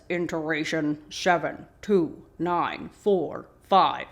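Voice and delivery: slow, measured robot recording; slow mechanical monotone; robotic voice. Retold or imitated. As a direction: imitate a robotic radio voice, word by word. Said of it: "a robotic voice on the radio says".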